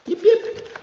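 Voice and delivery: two very high little yips; playful yipping. Pretend. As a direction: yip in a tiny high voice. Playful noise while handling the book.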